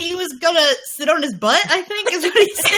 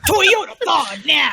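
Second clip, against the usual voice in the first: monster truck ad; slower than usual. Pretend. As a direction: yell it like a rally ad. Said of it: "like the monster truck rally ads".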